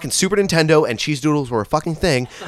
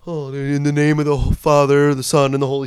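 lightly intoned mock priest blessing; a hint of cod-priest liturgy; shitty priest voice; 3 semitones higher than usual. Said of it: "a shitty priest voice".

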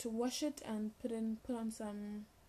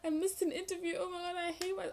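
panicked; 8 semitones higher than usual. Retold or imitated. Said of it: "So I was like".

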